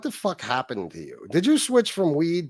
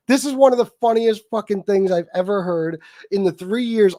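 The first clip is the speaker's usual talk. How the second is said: ordinary talk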